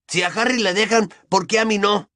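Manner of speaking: put-on high-pitched boy's protest. A narrator's reading. Boy protesting that his friend may stay, indignant. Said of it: "protestó Ron".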